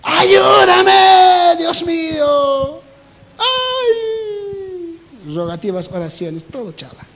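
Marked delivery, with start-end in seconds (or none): 0.0-2.8 high, loud crying plea to God, trailing off slow; mock desperate plea. Mocking believers who beg God for money, crying out. 3.4-5.0 mock wail. Mock crying sigh.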